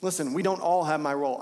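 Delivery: rising sermon fervor; full-force preaching. Acted. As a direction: drive the point home from the pulpit. Preaching builds over music.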